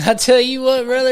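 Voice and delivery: high-pitched country twang; mock country accent. Parroting a country catchphrase.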